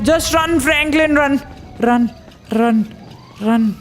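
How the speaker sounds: high urgent urging. Real, not acted. Gamer urging Franklin to run from the ghost, urgent. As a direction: push yourself to run, urgently.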